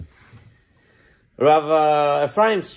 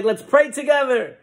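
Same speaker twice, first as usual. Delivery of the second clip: high revival call; preacher rallying crowd; 10 semitones higher, 4 dB louder than usual. Acted. Preacher tells everybody to pray, rallying high. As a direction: summon the whole room to prayer. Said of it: "he tells everybody".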